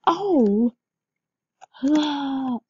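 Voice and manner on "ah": ordinary delivery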